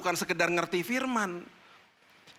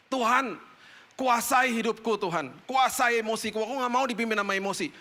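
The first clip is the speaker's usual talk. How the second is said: urgent prayer; 7 semitones higher than usual. Retold or imitated. Pleading prayer in anger.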